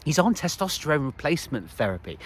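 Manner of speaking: normal talking voice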